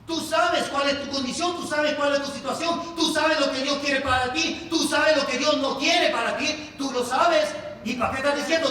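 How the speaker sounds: loud, rhythmic, hammering repetition; full-force preaching. Real, not acted. Preacher confronting each believer about their own condition, full-force preaching. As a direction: pound each phrase out like a preacher.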